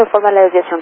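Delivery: ordinary talk, nothing marked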